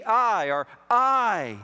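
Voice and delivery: I punched hard and loud, held alone; stress on I. Shown as a demonstration. Preacher showing the Hebrew emphasis on I to his congregation, loud punch. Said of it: "emphatic in the verse is I".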